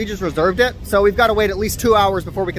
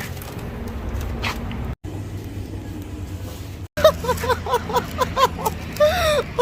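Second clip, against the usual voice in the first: delighted high laugh; 6 dB quieter than usual. Real, not acted. Opening the surprise bag.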